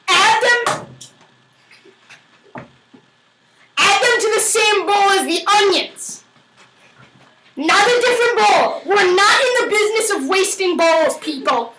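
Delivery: high, forceful instructions; mock-stern orders. Cook ordering viewers to reuse the bowl, mock-stern. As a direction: lay down the law like a drill instructor.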